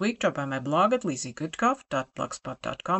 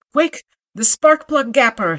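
loud urgent shout, much higher; 9 semitones higher, 12 dB louder than usual. Acted. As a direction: yell it out for the tool. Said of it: "he shouted".

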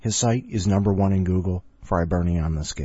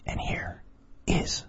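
hushed; 8 dB quieter, slower than usual. Pretend. Hushed announcer lead-in.

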